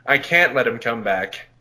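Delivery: normal talking voice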